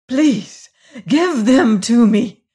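high, loud and slow, trembling with eagerness; tremulous eagerness. A narrator's reading. Servant begging the woman for the letters, tremulous and eager. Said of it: "said the man with tremulous eagerness".

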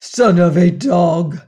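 slow, loud furious curse; angry cry. A narrator's reading. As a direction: spit the insult in outrage. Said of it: "he cried".

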